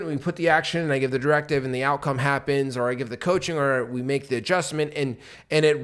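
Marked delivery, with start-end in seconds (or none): none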